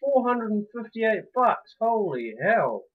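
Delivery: loud, slow, stretched amazement; amazed exclamation. Real, not acted. Player finds a thug's money, loud amazed exclamation. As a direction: draw it out, loud and astonished.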